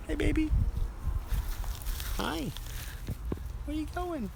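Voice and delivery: high, soft cooing to a pet. Real, not acted. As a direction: coo after the cat. Calling to the cat sweetly.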